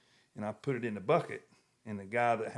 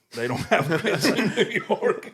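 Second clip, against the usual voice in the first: laughing while telling it, higher and louder; can't hold back the laugh; words breaking up with laughter; laughing through it; 8 semitones higher, 8 dB louder than usual. Real, not acted. Retelling the waitress's answer.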